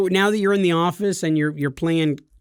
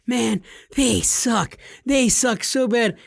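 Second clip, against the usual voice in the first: higher, very slow whine; mock whiny complaint; 6 semitones higher, much slower than usual. Mocking a friend's old complaints about coworkers in a slow, higher whine. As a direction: drag out the complaint like a sulky kid. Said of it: "when you'd be like".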